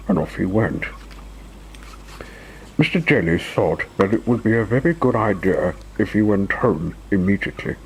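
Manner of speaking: plain reading voice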